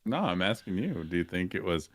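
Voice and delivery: ordinary talk, nothing marked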